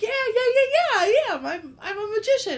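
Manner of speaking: high, loud, eager "yeah, I am" from the magician. Retold or imitated. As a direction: play the magician proud of his tricks. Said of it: "He's like".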